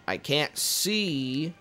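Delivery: halting, very slow, soft delivery; slow, distracted. Real, not acted. Player who can't see asking forgiveness, slow and distracted. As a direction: spread the words out with pauses.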